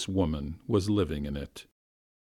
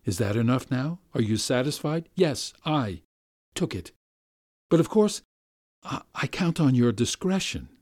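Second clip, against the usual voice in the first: higher, then dropping to a hush; shamed gentleman; 4 semitones higher than usual. Acted. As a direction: confess then beg secrecy. Nikolay after confessing.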